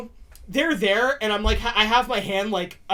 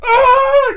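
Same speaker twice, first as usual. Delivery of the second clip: high, loud pained cry of mock agony; Coyote Peter yelp; 12 dB louder than usual. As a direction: yelp like you've just been stung, hammed-up. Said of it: "like Coyote Peter".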